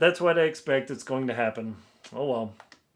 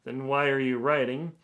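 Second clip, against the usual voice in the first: ordinary talk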